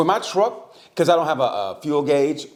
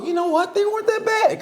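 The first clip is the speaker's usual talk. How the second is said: much higher, laughing concession; mock grudging voter. Voter won over after meeting politicians, high and laughing. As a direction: squeak out the reluctant praise. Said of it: "you go".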